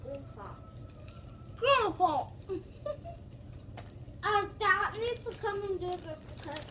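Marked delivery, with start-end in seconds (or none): 0.0-2.3 talking with a mouthful of popcorn; cheeks stuffed full. Real, not acted. Chubby Bunny, popcorn stuffed in mouth. 2.4-4.2 airy laugh through a full mouth; breathy laugh. Real, not acted.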